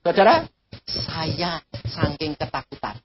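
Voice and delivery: ordinary delivery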